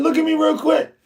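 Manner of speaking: high and insistent; urgent demand. Retold or imitated. Pressing his friend to show his face, high and insistent. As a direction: pitch it up, insist he turn around.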